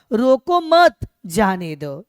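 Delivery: रोको मत loud as one unit, a break, then जाने दो; pause after मत. Shown as a demonstration. Teacher showing the moved comma, pause after मत. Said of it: "वही comma वहां से हटाकर".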